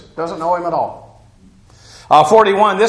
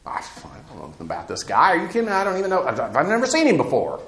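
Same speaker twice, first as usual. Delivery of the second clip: mock casual denial; 6 dB quieter, faster than usual. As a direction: shrug it off like a nervous liar. Peter's denial paraphrased casually.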